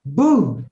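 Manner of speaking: punchy vocal "boom" as sound effect; sudden imitated impact burst. Teacher mimicking the blow of the words on the crowd, punchy boom.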